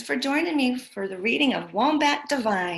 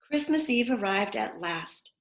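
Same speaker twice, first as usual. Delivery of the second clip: ordinary delivery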